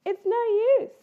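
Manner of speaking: high, loud fed-up mother bear. Acted. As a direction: sigh it out as a tired mum bear. Missus Bear voiced, exasperated.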